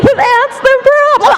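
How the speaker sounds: shrill it out like the mirror voice; nagging inner voice. Acted. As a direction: inner critic mocking her in the mirror. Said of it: "She's like".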